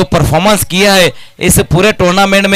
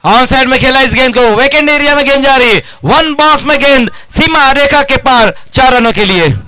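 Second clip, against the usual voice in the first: rising, faster call of the boundary; excited commentary; 4 semitones higher than usual. Real, not acted. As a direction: call the four with building excitement.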